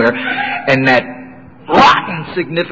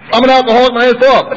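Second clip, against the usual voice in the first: defiant declaration; 8 semitones higher, 4 dB louder than usual. Retold or imitated. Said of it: "I said".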